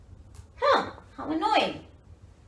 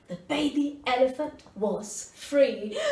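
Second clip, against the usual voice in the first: ordinary delivery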